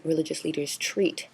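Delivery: ordinary talk, nothing marked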